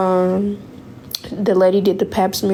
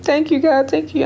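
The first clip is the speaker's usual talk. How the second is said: high, teary thanks to God; relieved near-sobbing gratitude; choked-up relief, voice pitched up; tearful thanks; 6 semitones higher than usual. Retold or imitated. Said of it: "I'm crying".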